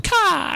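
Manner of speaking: loud high cawing squawk; crow caws. Acted. Dying bird player cries to friends, loud crow caw.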